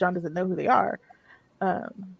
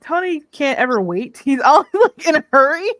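excited laughing; 7 semitones higher, 7 dB louder than usual. Real, not acted. Describing hurried Tony, laughing.

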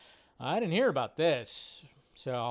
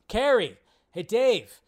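calling out; 6 semitones higher, 10 dB louder, slower than usual. Real, not acted. Calling out chat names loudly.

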